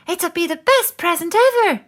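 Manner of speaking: high and loud with eager excitement; excitedly. Acted. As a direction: bubble over with excitement. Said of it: "said Moose excitedly".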